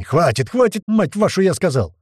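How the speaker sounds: loud, exasperated commander's shout; yelling. A narrator's reading. Tuman demands the firing stop, yelling. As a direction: yell it like a fed-up commander. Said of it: "начал орать Туман, махая руками".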